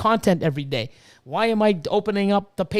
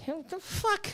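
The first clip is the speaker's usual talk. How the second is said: high, breathy disbelief; can't believe it, pitched way up; incredulous; 11 semitones higher, 11 dB quieter, slower than usual. Real, not acted. Disbelief at critics.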